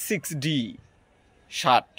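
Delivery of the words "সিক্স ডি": সিক্সটি flapped to সিক্স ডি, American style; American accent. Shown as a demonstration. Teacher contrasting accents, American সিক্স ডি. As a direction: let the t go soft like a d, American. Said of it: "American উচ্চারণে হবে".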